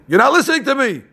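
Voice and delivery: mock scolding nurse. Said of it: "That's what she's telling the blinds".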